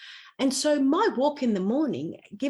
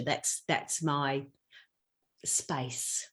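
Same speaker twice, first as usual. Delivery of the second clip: szept; 6 semitones lower, 7 dB quieter, much slower than usual. Real, not acted. Savouring her quiet morning time.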